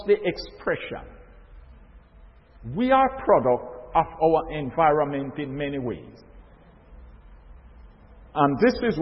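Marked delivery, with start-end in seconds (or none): none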